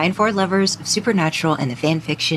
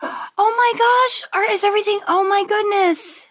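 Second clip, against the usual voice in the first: shocked dismay; 14 semitones higher than usual. Real, not acted. Host hears her guest is in the ICU, shocked and high. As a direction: pitch jumps way up in sudden alarm.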